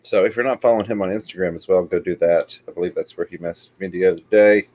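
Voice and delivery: ordinary talk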